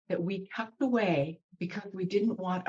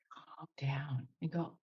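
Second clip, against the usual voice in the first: hushed whisper; 4 semitones lower, 10 dB quieter than usual. Real, not acted. Teacher whispering to listeners, hushed and reassuring.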